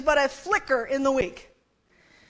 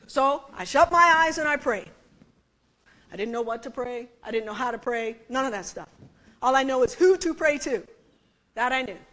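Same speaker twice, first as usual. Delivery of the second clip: ordinary talk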